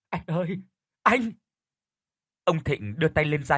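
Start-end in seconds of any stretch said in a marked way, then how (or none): 0.1-1.3 high, breaking wail of his name; sobbing cry. A narrator's reading. Weeping wife calls out to Thịnh in the hospital lobby. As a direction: cry out to him through sobs. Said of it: "bà càng nức nở hơn".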